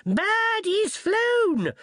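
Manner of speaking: very high, very slow drawn-out yell; wizard's yell. Acted. Wizard on a porter's back yelling the hunt, very high and slow. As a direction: stretch the call out like a hunting horn. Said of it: "a wizard yelled".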